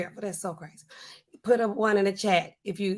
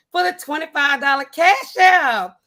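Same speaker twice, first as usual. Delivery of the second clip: loud, higher-pitched showy announcer; hammed-up donation announcement; 5 semitones higher, 7 dB louder than usual. Streamer announcing a twenty-five dollar donation, loud showy announcer delivery. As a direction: proclaim it like a big prize.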